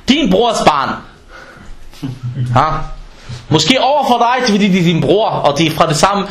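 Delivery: usual speaking voice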